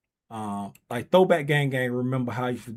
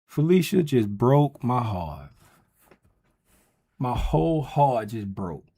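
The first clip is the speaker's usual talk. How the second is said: mock heartbreak; 3 semitones lower, slower than usual. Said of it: "I'm crying".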